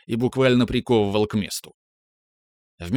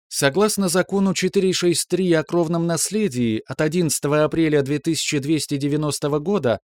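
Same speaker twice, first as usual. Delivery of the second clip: ship computer voice; 5 semitones higher than usual. Acted. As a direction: report evenly like a ship's AI. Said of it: "механический голос бортового компьютера продолжал".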